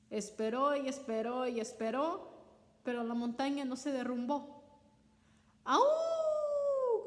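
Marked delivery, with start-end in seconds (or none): 5.6-7.0 un alarido agudo y muy alto de Coyote; aúlla de dolor como Coyote engañado; grito de dolor. Acted. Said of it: "gritó". (grito agudo de dolor)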